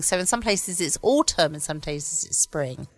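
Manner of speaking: usual speaking voice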